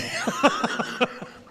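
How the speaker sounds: a high burst of real laughter. Real, not acted. Priest laughing at his own joke before the crowd. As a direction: break into high-pitched laughter.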